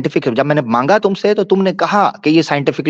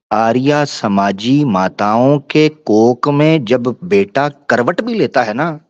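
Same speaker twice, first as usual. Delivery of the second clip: ordinary talk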